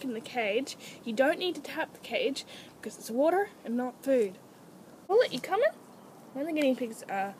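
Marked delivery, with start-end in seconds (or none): 5.1-5.7 high, lilting question to an animal; high-pitched pet voice; calling a pet. Real, not acted. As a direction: coax your pet with a rising question. Calling guinea pig.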